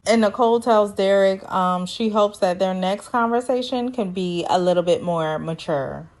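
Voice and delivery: ordinary delivery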